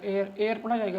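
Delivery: एयर said with no h sound; एयर, not हेयर; silent h. Shown as a demonstration. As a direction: say एयर starting on the vowel, no h. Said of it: "हेयर नहीं".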